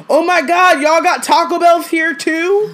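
high, loud mock amazement; amazed cousin. Host imitates her cousin gushing over Taco Bell, high and loud. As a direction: gush over something ordinary.

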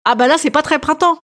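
ordinary delivery

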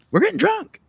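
high giddy quote; imitating [name]. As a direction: squeal it out giddy. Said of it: "Anderson's just".

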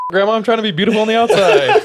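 high, loud, fast whining to grandma; mock whiny protest. Voicing Mallory answering the grandma, high fast mock whine. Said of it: "It's like".

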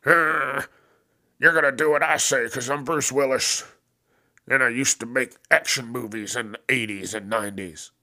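imitating an entitled action hero; mock Bruce Willis. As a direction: puff up like an arrogant old star. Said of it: "mocking Bruce's voice".

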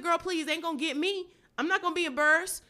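high, loud, rapid self-talk; hyped self-talk. Retold or imitated. Comedian on stage hyping herself up, high and loud. Retold inner monologue on stage.

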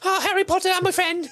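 high squeaky free elf; proud Dobby. Retold or imitated. Dobby boasting of his freedom in a high squeak.

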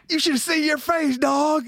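high, teasing retold jeer; mock prankster taunt. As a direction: crow it like a prankster after a scare. Quoting the prankster who sprinted up.

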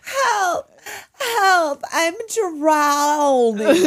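high, loud fake cries for help; mock drowning cry. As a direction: ham up a drowning kid calling for help. Acting out Amanda drowning.

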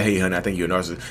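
low, quick, soft aside; mock gentle wife. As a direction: toss it off softly and fast like a sweet suggestion. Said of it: "My wife didn't say, she's like".

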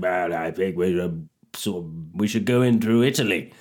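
low, mock Churchill musing; a hint of Churchill mulling it over; Churchill impression. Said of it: "Churchill said".

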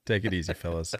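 light imitation, dropping away at the end; mimicking the caller. Host replaying the caller's parting line, lightly imitated. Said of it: "just burns a fan base at the stake".

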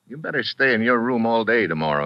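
normal talking voice

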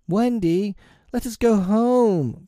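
put-on high, slow child's plea; crying out. A narrator's reading. Frightened brothers begging Wendy to go home, crying out. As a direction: plead in a high little boy's cry. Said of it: "cried John and Michael together".

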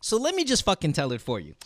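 normal talking voice

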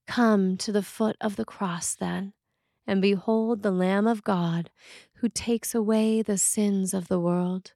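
normal talking voice

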